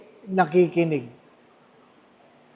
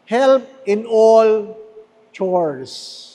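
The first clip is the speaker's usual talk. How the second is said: slow emphasis; much slower than usual. Real, not acted. Translates slowly for emphasis.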